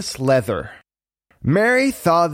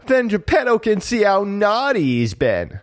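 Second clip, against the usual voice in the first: put-on high-pitched boyish mischief; teasing puppet boy; 7 semitones higher than usual. A narrator's reading. Pinocchio replying to Jiminy about Figaro, teasing.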